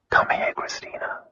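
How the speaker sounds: a husband softly calling his wife closer; soft whisper. A narrator's reading. Husband whispering his wife over, soft. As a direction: whisper it, breathy and hushed. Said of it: "His voice was whisper soft".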